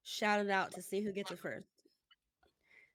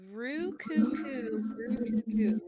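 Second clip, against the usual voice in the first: slow, drawn-out louder coo; cooing pigeon voice; 3 semitones higher, 8 dB louder, much slower than usual. Acted. Pigeons' call from Cinderella.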